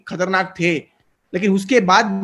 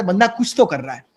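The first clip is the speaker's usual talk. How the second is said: ordinary talk, nothing marked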